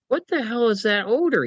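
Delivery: high, disgusted outburst at the smell; disgusted chief of staff. Retold or imitated. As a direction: recoil from the stink in the car. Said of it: "Andrew, goes".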